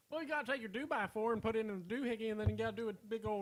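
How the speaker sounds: put-on high, fast country accent. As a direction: rattle off garbled instructions in a Southern twang. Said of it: "they try to tell you how to change a tire".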